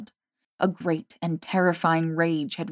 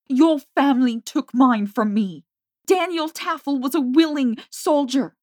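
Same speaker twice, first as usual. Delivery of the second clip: furious; 3 semitones higher, 7 dB louder than usual. A narrator's reading. Jamie's furious tirade.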